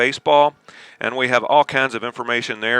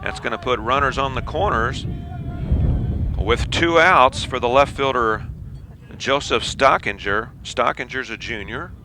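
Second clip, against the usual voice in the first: play-by-play; 3 semitones higher, 4 dB quieter than usual. Acted. Live baseball play-by-play.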